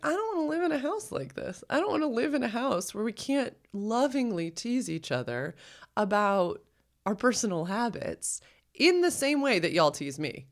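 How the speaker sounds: the hurt voice inside her belly, up high; high, whiny inner plea; high inner protest. Retold or imitated.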